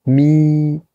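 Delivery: "mi" held high and level; "mi" as a Mandarin first tone, high and steady. Shown as a demonstration. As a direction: sustain "mi" on one high, even note. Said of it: "et vous avez un premier ton".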